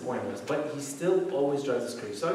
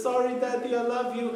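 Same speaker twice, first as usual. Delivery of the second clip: put-on high-pitched child's apology; sweet little boy; 9 semitones higher than usual. Son apologizes to angry dad, sweet child voice. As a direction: imitate a small son melting dad's anger. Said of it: "and he says".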